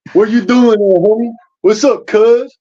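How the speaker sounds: put-on high LA street accent; Grape Street LA accent. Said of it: "Grape Street".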